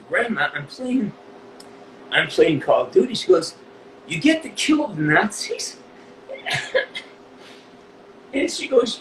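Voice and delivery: high voice tight with real tears; choked up. Real, not acted. Interviewee retelling grandmother and grandson's exchange, choked up. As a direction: push the story out while close to crying, halting. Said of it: "This, this fucks me up".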